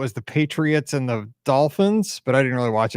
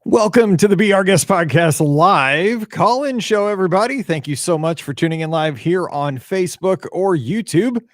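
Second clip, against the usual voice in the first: upbeat host welcome, louder; show opener; 6 semitones higher, 4 dB louder than usual. Acted. Host welcoming live viewers, announcer style.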